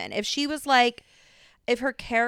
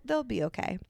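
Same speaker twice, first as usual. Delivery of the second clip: usual speaking voice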